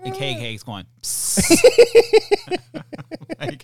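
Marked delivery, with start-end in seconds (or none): 0.9-3.3 put-on squealing kid cackle, loud and high; high loud shrieking laugh. Kid laughing hysterically, imitated.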